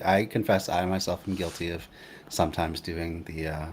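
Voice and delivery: usual speaking voice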